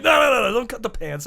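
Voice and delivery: high, loud string of noes; urgent protest. Retold or imitated. Rider to ambulance crew about his pants, urgent high protest. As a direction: blurt it out to stop them.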